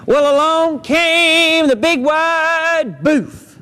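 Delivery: slow suspenseful narration; ominous storyteller. A narrator's reading. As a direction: stretch it out like a spooky tale.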